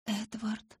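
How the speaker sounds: high whispered call; feeble call. A narrator's reading. Injured girl attempting to call Edward, feeble whisper. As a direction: try to call out, barely voiced. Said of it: "снова попробовала позвать я".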